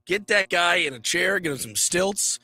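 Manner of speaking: ordinary talk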